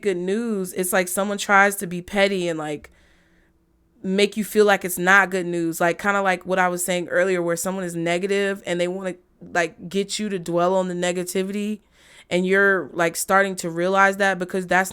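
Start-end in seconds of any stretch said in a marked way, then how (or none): none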